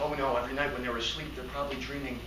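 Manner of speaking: low, soft, sinking voice; hushed realization. Acted. Man realizing what sleeping monkeys dream, hushed realization. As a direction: drop down toward a dreamy hush.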